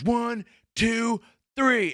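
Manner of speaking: high, strained mock cop count; raging countdown. Cop finishing the countdown on the kid, raging. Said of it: "and he goes".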